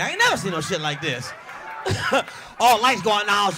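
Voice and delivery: ordinary talk, nothing marked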